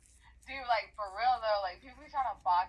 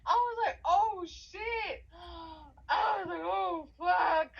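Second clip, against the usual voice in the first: high, loud, drawn-out cries through laughter; busting out laughing; 11 semitones higher, 8 dB louder, slower than usual. Real, not acted. Reliving the movie moment, laughing uncontrollably. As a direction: wail it out while cracking up. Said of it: "I busted out laughing".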